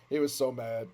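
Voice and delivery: usual speaking voice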